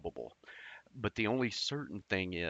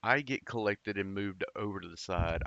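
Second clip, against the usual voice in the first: normal talking voice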